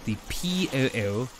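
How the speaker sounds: demonstrated snooty English accent; posh English accent. Shown as a demonstration. Streamer showing how to get into it, posh English accent. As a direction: keep the posh English vowels, explaining as you go. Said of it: "That's how I get into that accent".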